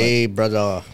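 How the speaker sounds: low mock Ukrainian greeting; put-on Ukrainian accent, low voice; attempted Ukrainian accent. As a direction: try a rough guess at a Ukrainian accent, pitched low. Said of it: "they're Ukrainian".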